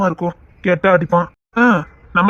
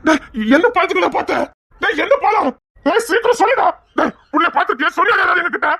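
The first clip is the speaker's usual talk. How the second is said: high-pitched, panicked questioning; desperate and insistent; frantic interrogation; 6 semitones higher than usual. Acted. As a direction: demand answers urgently, voice straining upward. Frantically interrogating about missing girl.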